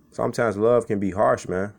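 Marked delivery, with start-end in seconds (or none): none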